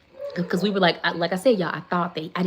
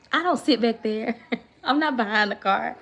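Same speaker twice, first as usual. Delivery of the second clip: laughing; 5 semitones higher, 3 dB louder than usual. Real, not acted. Laughing at own complaint.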